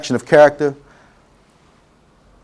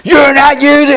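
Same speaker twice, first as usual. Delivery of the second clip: high, loud imitation of a yelling heckler; mock angry heckler; 11 semitones higher, 4 dB louder than usual. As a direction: mimic the stranger yelling in his face. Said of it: "he's yelling at me".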